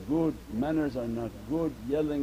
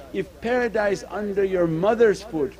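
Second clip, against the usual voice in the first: rising fervour; 6 semitones higher, 6 dB louder than usual. Real, not acted. Preacher building to a climax.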